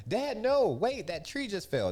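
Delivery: put-on high, loud frightened child's cry; panicked kid. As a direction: shout it like a scared kid in the car. Kids panicking in burning forest.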